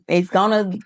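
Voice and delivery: ordinary delivery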